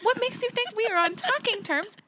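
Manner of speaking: indignant disbelief, rising. Retold or imitated. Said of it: "[name] retells her own outrage".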